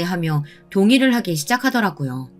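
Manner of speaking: usual speaking voice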